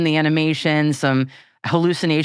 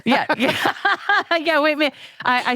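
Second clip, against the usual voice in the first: laughing; 11 semitones higher than usual. Real, not acted. Bursts out laughing.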